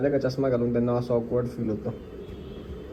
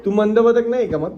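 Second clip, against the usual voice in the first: higher, louder teasing jab; higher playful taunt; 7 semitones higher, 5 dB louder than usual. Real, not acted. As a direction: needle her playfully.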